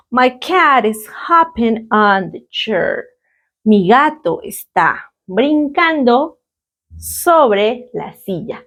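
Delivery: unhurried teacher reading out an example; drawn-out words for learners to follow; slow model sentence. Shown as a demonstration. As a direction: say it slowly, word by word. Teacher models sentence, then translates.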